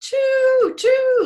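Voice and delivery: very high, loud, long choo-choo; high piping whistle call; train whistle. Shown as a demonstration. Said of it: "like the whistle".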